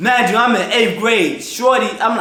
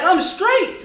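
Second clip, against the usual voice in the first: cocky reassurance; 11 semitones higher than usual. Retold or imitated. Quoting his own cocky self.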